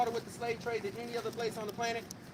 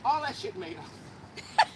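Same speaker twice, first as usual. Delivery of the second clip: stifled giggles; 5 semitones higher, 4 dB louder, much slower than usual. Real, not acted. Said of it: "I'm trying not to laugh right now".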